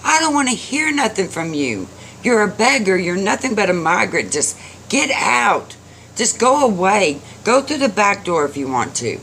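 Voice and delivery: high-pitched scolding rant; angry yelling. A narrator's reading. Old man screams at begging boy, angry. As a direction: rail at him, voice pushed high. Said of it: "the old man just yelled and screamed at him".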